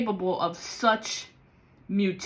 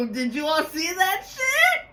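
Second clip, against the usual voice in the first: high, shouting, laughing disbelief; excited shout; 9 semitones higher than usual. Real, not acted. Asking viewers if they saw the flame, shouted in high disbelief. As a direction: yell it out to the viewers, still shaken.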